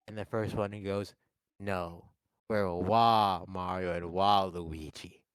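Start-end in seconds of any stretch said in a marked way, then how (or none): 1.6-5.2 slow sly villain voice. Retold or imitated. Cousin names himself.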